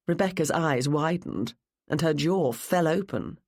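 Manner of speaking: plain reading voice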